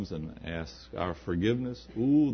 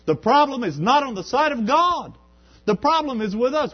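high, loud pulpit climax; rising sermon shout; preaching at full force; 13 semitones higher, 9 dB louder than usual. Acted. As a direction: drive it home to the congregation.

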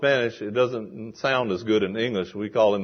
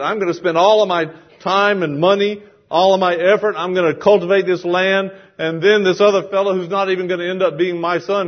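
aggrieved protest; 5 semitones higher, 4 dB louder than usual. Acted. Acts out kinsman's worried protest.

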